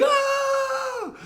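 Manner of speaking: high mock scream; a comic yelp as he drops; falling yell. Guard snapping off the ropes, high falling yelp.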